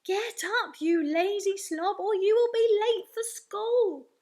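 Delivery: loud, high, drawn-out parental bellow; father's scolding voice. Acted. Father waking son for school, angry bellow. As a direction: holler it at a lazy child, stretching the end. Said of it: "hearing my father's real voice saying".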